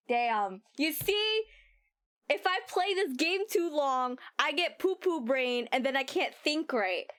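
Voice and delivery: normal talking voice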